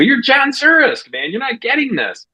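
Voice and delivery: high, drawn-out protest at a friend; exasperated pep talk. Retold or imitated. Acted pep talk to doubting friend.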